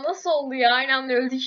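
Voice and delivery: usual speaking voice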